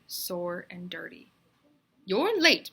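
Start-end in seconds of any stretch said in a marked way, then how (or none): 2.0-2.7 loud, high teacher scold; teacher scolds Myron loudly for coming late. Acted. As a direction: snap at the late pupil.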